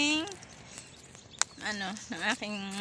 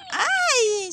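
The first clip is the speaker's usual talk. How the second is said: startled yelp; 12 semitones higher, 8 dB louder than usual. Real, not acted. Startled on the walk.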